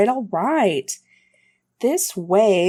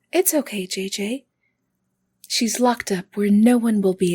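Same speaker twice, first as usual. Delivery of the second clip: eerie sweet voice; 3 semitones higher than usual. Acted. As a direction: comfort him in a sinister way. Possessed woman reassures eerily.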